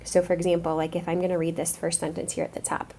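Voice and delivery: ordinary talk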